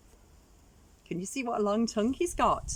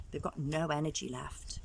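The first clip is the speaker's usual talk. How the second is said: ordinary delivery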